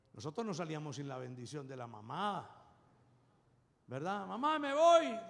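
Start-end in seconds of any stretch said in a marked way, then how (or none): 4.3-5.2 put-on child saying goodbye. Child leaving, asks mother's blessing.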